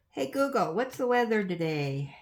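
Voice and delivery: loud, clear command to smart speaker; talking to voice assistant. Real, not acted. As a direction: call out to the device.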